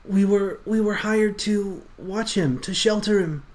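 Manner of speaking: nervous little rat man. Acted. GM voicing nervous rat NPC.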